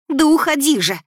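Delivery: high, loud, desperate bark; barked order. A narrator's reading. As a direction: drive him away with a shout. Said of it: "рявкнула я". (рявкнуть в отчаянии)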